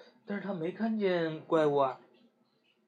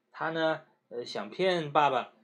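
usual speaking voice

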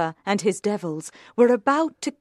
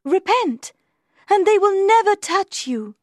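very high, loud, urgent angel; good angel; 12 semitones higher, 5 dB louder than usual. A narrator's reading. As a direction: exhort him in a high, ringing voice. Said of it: "the good angel said".

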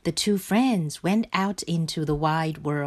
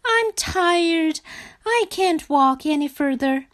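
high, whiny frog moan; 10 semitones higher than usual. A narrator's reading. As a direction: moan wearily in a high little voice. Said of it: "he moaned".

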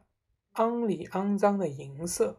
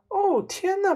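put-on high, louder boy's exclamation; alarmed cry; 14 semitones higher, 5 dB louder than usual. A narrator's reading. Son joins in at father's hair, crying out. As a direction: cry out in shock. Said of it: "儿子也叫起来".

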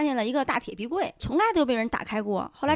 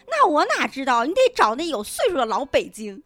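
put-on old Beijing cabbie; 4 semitones higher, 6 dB louder than usual. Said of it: "他说".

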